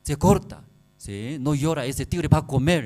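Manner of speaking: high, loud scolding mother; mother threatening. Retold or imitated. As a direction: scare the child into silence, high and loud. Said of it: "el bebé". (madre amenaza al bebé, aguda y fuerte)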